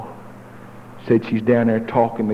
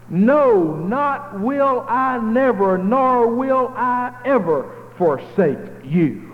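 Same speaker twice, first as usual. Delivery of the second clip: higher, slow, soaring sermon cadence; preaching at full force; 9 semitones higher, slower than usual. Acted. Preacher rising to climax over the congregation, slow and high. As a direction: lift the scripture up slow and ringing.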